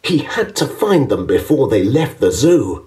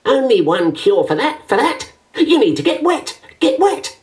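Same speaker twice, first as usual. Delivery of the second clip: high, bouncy chattering bird voice; chattering penguin; 13 semitones higher than usual. A narrator's reading. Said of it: "A penguin chattered".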